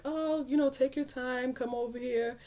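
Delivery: casual reassurance. Retold or imitated. Husband telling her aunt not to hurry, breezily. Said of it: "So he's telling my aunt".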